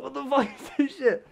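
high, shocked outburst; startled cry. Retold or imitated. Retelling the collapse to a friend, high startled yelp. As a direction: squawk it out in disbelief.

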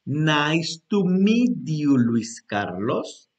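very slow, stretched words; slow example reply. Shown as a demonstration. Teacher answering as the other person, very slowly. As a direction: answer slowly, spacing out every word. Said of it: "como si fuera la otra persona contestando".

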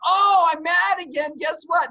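high, loud hammed-up anger; mock angry shout. Speaker acting out getting mad again, mock angry shout. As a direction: yell it like a sulky grump. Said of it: "get mad because".

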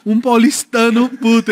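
put-on São Paulo accent. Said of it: "eu parecia, sabe o quê".

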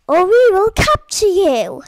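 loud threatening alien voice; menacing alien. Acted. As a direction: bellow it like a space villain.

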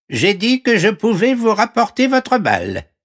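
very high frog voice; squeaky-high frog offering help; talking frog. Acted. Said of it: "la grenouille". (voix de grenouille très aiguë)

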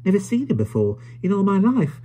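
high-pitched, marvelling little voice; admiring old toymaker. Acted. Caleb picturing the stranger as a nutcracker, high admiring voice. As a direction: size him up in a high, swooping voice.

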